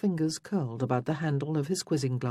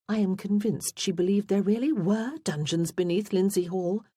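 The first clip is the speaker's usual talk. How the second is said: higher-pitched, chattering reminiscence; opinionated younger sister; 5 semitones higher than usual. A narrator's reading. Morgan tells family of her old governess, opinionated. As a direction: keep the pitch up as she airs her grievance.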